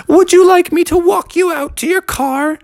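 very high, polite offer; high gallant Froggy. A narrator's reading. Froggy offering to walk Beatrice out, very high.

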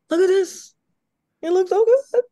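gasping, high-pitched loud gushing; high, thrilled fake reaction; mock awe. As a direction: squeal it in exaggerated delight. Acted awed reaction to seeing the game.

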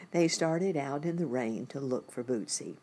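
plain reading voice

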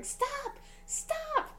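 high falsetto mock yell, held back in volume; retold screaming. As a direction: imitate screaming without the loudness. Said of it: "I could hear her screaming".

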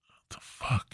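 whispered disbelief. Real, not acted. Comic whispering his disbelief after the cashier, unvoiced. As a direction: hush it out under your breath. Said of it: "whispered aside".

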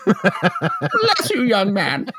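put-on high old-lady voice, dropping toward the end; hammed-up old lady, pitched high. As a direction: thank a young helper as an old lady. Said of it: "working on my old lady voice".

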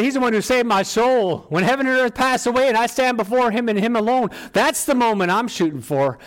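high, loud, driving sermon delivery; fervent preaching. Acted. Preaches at full force.

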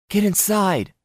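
high, panicked shout; frantic cry. A narrator's reading. Lorelei yells at Stephen from the yard, high and frantic. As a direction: yell at them to get in, alarmed. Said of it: "Maddie cried".

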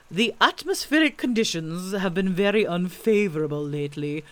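loud, ponderous learned owl; self-important lecturing bird voice; pompous Owl. A narrator's reading. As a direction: pronounce it weightily, savouring the long words. Owl pronouncing in long words.

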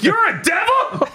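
very high shocked mock squeal; comic freak-out. Bat seeing the arm sawn off, comic freak-out. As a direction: freak out, pitch leaping way up. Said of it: "Bat freaks the fuck out".

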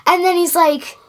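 ordinary talk, nothing marked

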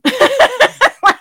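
bursts into a high, loud cackle at her own joke; real laughter, loud and high. Real, not acted. Speaker cracking up at her own cheeky line, high loud laugh.

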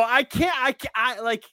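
ordinary delivery

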